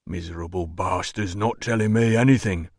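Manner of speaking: low-key gruff doorman voice; disgruntled doorman. A narrator's reading. Doorman complaining about his captive, grumbling.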